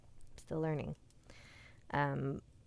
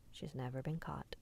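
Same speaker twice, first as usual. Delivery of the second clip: soft, near-whispered last line; hushed ending; 3 semitones lower, 10 dB quieter than usual. A narrator's reading. Storyteller closing a scary legend for listeners, hushed. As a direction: let the legend close in a hush.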